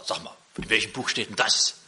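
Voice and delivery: astonished guest asking which book this is from; amazed visitor. Retold or imitated. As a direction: play the stunned businessman hearing the text. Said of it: "hat ihm den Text vorgelesen und sagt".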